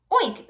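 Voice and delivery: high, loud pig oink. Acted. Pig at the window oinking to wake Baby, high and loud. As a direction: oink through the window glass. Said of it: "said Mercy".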